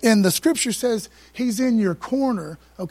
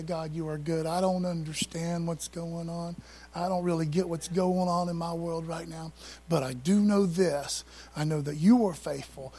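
hushed, sincere prayer, much softer; quiet prayer; 4 semitones lower, 4 dB quieter than usual. Pretend. Believer praying quietly through pain, hushed and sincere. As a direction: pray it softly through the pain. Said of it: "push through the pain and say".

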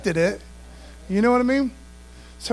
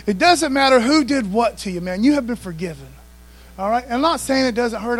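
usual speaking voice

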